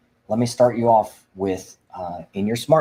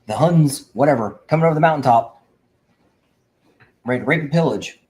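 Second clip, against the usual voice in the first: fired up; 5 semitones higher, 5 dB louder than usual. Real, not acted. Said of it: "this is where I get fired up".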